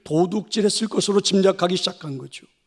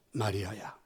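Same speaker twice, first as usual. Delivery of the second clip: the name almost breathed, much softer and lower; barely spoken; 10 semitones lower, 15 dB quieter, slower than usual. A narrator's reading. Jesus calls Mary a last time, barely audible. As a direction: let the last call fade to nearly nothing. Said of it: "그분이 부드러운 소리로 이렇게 말씀하셨습니다".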